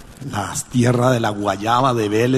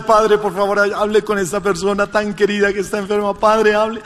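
pleading woman; 9 semitones higher than usual. Retold or imitated. Woman begging on phone.

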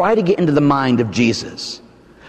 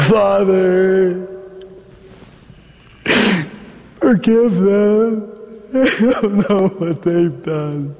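slow, strained plea from the cross; dying Jesus; 3 semitones higher, much slower than usual. Acted. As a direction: plead slowly, out of breath.

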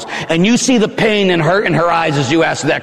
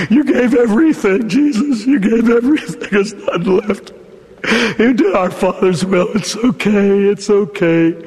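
high, broken, grieving whisper; grieving tenderness; 3 semitones higher than usual. Acted. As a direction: mourn over a lost son. Mother grieving over son.

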